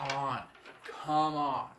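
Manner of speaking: loud mock-exasperated voice; mock them with drawn-out disbelief. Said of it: "in that voice is because I'm mocking".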